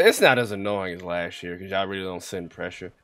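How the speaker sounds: ordinary delivery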